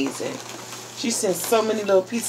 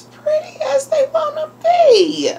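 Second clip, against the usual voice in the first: high, voice climbing toward tears; happy tearful; 4 dB louder than usual. Real, not acted. Crybaby moved by a friend's gift, tearful gushing. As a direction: gush as if about to cry. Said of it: "I'm trying not to cry".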